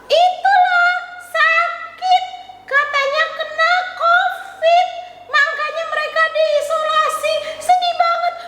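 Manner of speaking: high puppet voice, mock-sad; childlike puppet, lightly sad; puppet girl Tiur. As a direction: tell the sad news as the little puppet.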